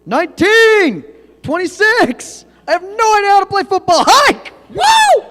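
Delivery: high, loud quarterback calling plays; excited football call. Acted. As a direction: bark the count like a hyped quarterback.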